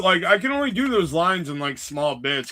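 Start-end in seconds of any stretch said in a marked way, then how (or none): none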